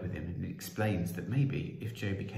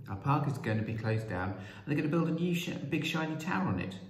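put-on higher-pitched, urgent young voice; pleading boy; 7 semitones higher than usual. Joe telling the Prime Minister about the park, pleading.

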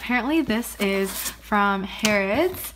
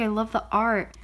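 ordinary talk, nothing marked

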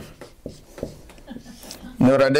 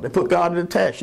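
ordinary talk, nothing marked

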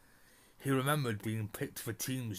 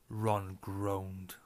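plain reading voice

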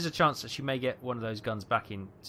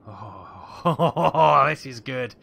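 loud laugh breaking into speech; delighted laughing; 11 dB louder, much slower than usual. Real, not acted. Gamer relishing a tense naval battle, delighted laughing. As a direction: laugh out loud through the words.

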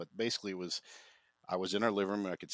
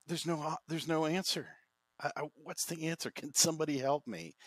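put-on higher, pleading retelling of a child's despair; desperate plea; 9 semitones higher than usual. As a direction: lightly relive the child begging for help. Child's despairing inner plea retold.

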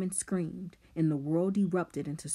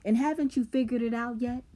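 quick, higher-pitched scornful question; taunting boy; 7 semitones higher, 4 dB louder, faster than usual. A narrator's reading. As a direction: jeer it fast and high. Simon taunts his listener.

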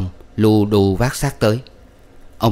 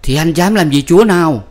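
challenging wife; 8 semitones higher, 5 dB louder than usual. A narrator's reading.

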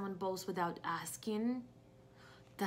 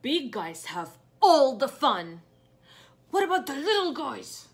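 angry Gerald; 4 semitones higher, 9 dB louder than usual. Acted. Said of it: "He feels angry".

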